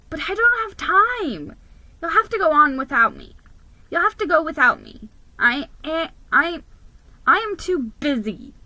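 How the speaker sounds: high, fast, stammering excuse; flustered refusal. Acted. Nervous mouse turns down his friends, high and flustered. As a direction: trip over the words in a rush.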